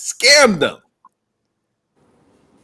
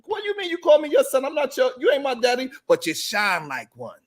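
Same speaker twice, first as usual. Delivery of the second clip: confused reply; 5 semitones higher than usual. Retold or imitated.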